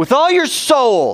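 high, drawn-out ringing call; with gusto. Shown as a demonstration. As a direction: lead the congregation in a full-voiced prayer call. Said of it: "say it with some gusto".

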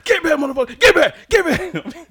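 high, forceful yell; retold yelling. Man yelling at two attacking dogs, retold yelling. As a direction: yell sharply to drive them off. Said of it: "And I'm yelling at them".

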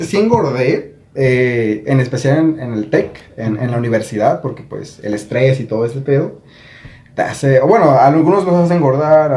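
ordinary delivery

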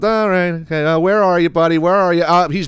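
lightly voiced careless owners; mock nonchalant. Careless cat owners calling lazily, mild mock nonchalance. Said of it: "they were just nonchalant".

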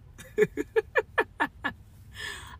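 breathy high-pitched burst of laughter. Real, not acted. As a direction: laugh out high, in a broken run. Bitter laugh while venting.

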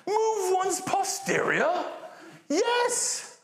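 very high, slow plummy voice; high mannered posh voice ending in a laugh; posh British. Retold or imitated. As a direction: overdo the prim Brit. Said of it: "Too British, too far".

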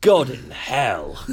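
very slow, deliberate delivery; savoured catchphrase. Shown as a demonstration. Trying out an accidental new phrase, slow and weighty. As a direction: stretch each word out like a proclamation.

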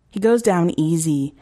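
usual speaking voice

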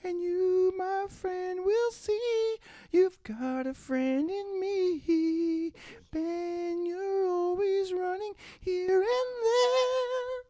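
put-on high slow child singing; kid voice. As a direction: croon high like a young boy. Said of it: "let me do the kid voice".

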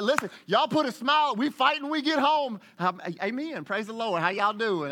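high loud scolding, then a forced amen; angry parent hissing. Retold or imitated. Parent scolding the family before church, loud and high. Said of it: "And you're like".

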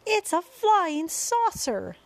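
high, loud, drawn-out crowd cry; excited shout. A narrator's reading. Crowd shouts at the strange sight in the sky, excited shout. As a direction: shout it out in amazement, stretching the words. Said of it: "they shouted".

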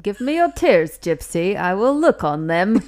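loud comic character voice, lilting up at the ends. Acted. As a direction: perform the silly catchphrase on request.

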